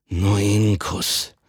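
langsam, lauernd gefordert; bedrohlich statt zärtlich. Acted. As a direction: fordere es bedrohlich ein. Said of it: "alles andere als zärtlich". (langsam und bedrohlich, gar nicht zärtlich)

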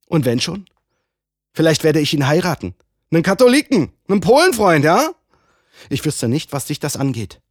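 ansteigend lauter und höher, herausfordernd; trotzige Provokation. Acted. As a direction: reiz ihn bewusst. (trotzig herausfordernd)

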